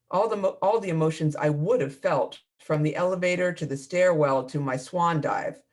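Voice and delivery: ordinary delivery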